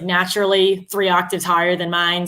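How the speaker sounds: ordinary delivery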